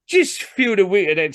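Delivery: eager high-pitched patter; showy salesman. Acted. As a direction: push the product in a high, loud character voice. Salesman showing off the book.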